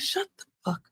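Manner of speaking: whispered under the breath, high and hushed; mock whisper to shut him up. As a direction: hiss it like a secret aside. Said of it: "I was like".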